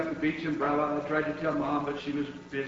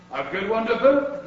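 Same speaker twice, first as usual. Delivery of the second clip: raised, louder echo with a rising end; crew agreeing; 5 semitones higher, 6 dB louder than usual. A narrator's reading. Pirate crew echoing their captain, loud agreement. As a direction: chime in heartily as the whole crew. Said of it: "the others all agreed".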